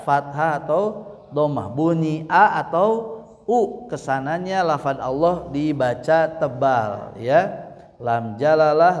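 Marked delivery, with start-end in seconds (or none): none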